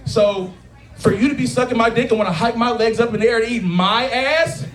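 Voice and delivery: ordinary talk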